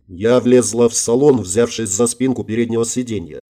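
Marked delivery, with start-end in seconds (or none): none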